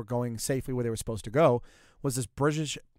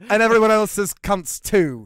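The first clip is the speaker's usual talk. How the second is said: put-on British accent; 7 semitones higher, 10 dB louder, slower than usual. Continuing mock British bit.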